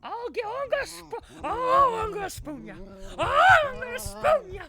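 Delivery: very high, very loud yells; shrieking meme voice. Pretend. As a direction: scream it over and over.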